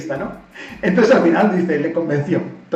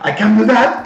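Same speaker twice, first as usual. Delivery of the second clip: louder, higher, confident claim; bold; 5 semitones higher, 7 dB louder than usual. Retold or imitated. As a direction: say it with daring, all in. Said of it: "el arrojo y la valentía".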